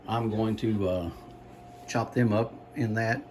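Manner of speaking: ordinary delivery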